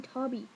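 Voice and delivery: "Tobby" with a short open AH in the first syllable; "Tobby", rhyming with hobby; pronounced TOBBY. Shown as a demonstration. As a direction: say "Tobby" like TAH-bee. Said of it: "Not like [name]".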